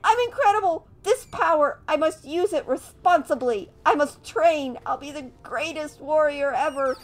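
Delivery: put-on high, loud, giddy kid voice; overjoyed little kid, laughing; terrible voice acting. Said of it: "Sorry about the terrible voice acting".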